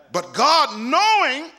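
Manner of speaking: high, emphatic, forceful preaching; full-force preaching. Real, not acted. Preacher on God preparing you through storms, full-force preaching. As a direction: drive each phrase up to a peak.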